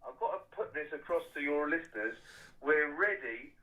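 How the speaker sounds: loud, slow, emphatic call to arms; rallying loud declaration. Real, not acted. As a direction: drive each word home like a battle cry. Caller rallying listeners for war.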